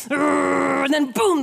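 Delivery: a loud, slow, drawn-out boom; dramatic boom. A narrator's reading. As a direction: land the boom hard and slow. Dog breaks loose in story.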